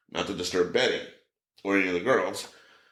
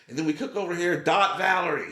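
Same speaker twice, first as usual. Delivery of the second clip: raised, higher-pitched call-out; mock yell; 7 semitones higher than usual. Son heckling his mom's cooking show, mock yell. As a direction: belt the tag line out like a joking son. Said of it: "he would just yell out".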